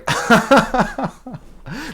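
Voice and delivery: loud burst of real laughter; high cackling laugh. Real, not acted. Teller at the end of his story, cackling laugh.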